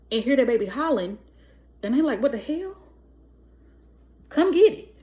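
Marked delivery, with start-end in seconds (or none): 2.2-4.9 louder, higher "Come get it" from a fed-up dad; mock the irritated father; annoyed dad. Mocking a father woken by his crying baby, annoyed.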